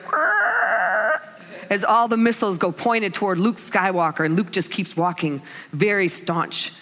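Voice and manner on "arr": high mechanical whirr sound effect; imitated missile noise. Preacher mimicking all the missiles turning toward Luke, high noise. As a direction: voice the weapons swinging into aim. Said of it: "And you hear the".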